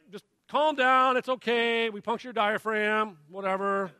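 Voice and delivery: dismissive medical staff. Retold or imitated. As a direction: imitate staff brushing off a panicking patient. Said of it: "and they're like".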